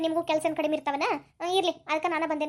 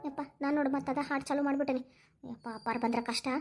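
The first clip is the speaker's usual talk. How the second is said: worried aside; 4 semitones lower, 7 dB quieter than usual. Acted.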